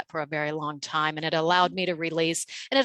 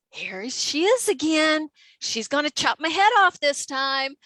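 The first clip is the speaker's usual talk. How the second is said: high, slow mock panic; freaking out; 7 semitones higher than usual. Employee dreading the angry customer's voice, mock panic. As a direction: imitate dreading the worst. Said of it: "I'm freaking out".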